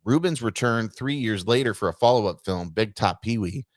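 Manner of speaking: ordinary talk, nothing marked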